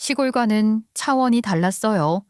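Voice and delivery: ordinary delivery, nothing marked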